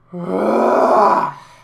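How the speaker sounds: a big, loud theatrical sigh; loud exasperated sigh. Acted. The narrator reacts to the girl's geography blunder with a loud, exasperated sigh. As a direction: let out a huge sigh of disbelief.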